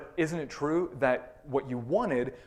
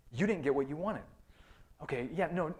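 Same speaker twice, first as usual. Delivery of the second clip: ordinary talk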